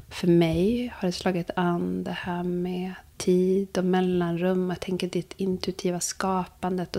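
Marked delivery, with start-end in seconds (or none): none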